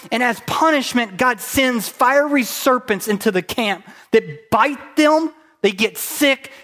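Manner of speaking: loud, driving sermon delivery; high, loud pulpit voice; preaching at full force. Acted. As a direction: preach it hard to the room.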